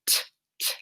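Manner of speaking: "T, t" as a soft breathy t, no voice; just the puff of the t sound; isolating the first sound in a whisper. Shown as a demonstration. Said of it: "What's the first sound?".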